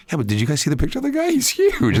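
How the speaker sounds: fast, high-pitched pleading objection; squeaky disbelief, retold; incredulous protest. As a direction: protest in a rush, climbing way up on the last words. Retold protest over using regular voice.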